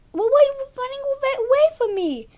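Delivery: high, fast, mock whiny kid voice. Boy mimics classmate Jack questioning him, high and whiny.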